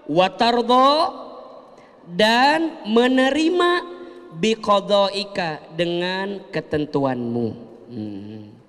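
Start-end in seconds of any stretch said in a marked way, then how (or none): 0.1-7.5 slow, drawn-out prayer recital; slow recitation. Shown as a demonstration. Teaching the prayer to the congregation, slow recitation. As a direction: recite each word slowly.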